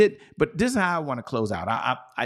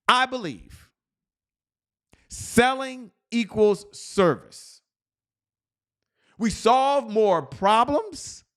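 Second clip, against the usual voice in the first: high, slow rallying affirmation with long pauses; repeat-after-me declamation; 9 semitones higher, much slower than usual. Shown as a demonstration. Coach leads the huddle through the belief statement, slow high declamation. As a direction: proclaim each line high and drawn out, leaving room to echo. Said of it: "But just repeat after me".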